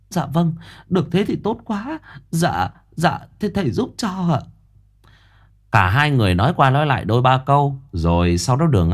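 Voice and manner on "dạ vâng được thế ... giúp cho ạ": higher, relieved and polite; grateful mother. Acted. Mother accepting Quý's offer, high and grateful. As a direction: thank him humbly, pitched up.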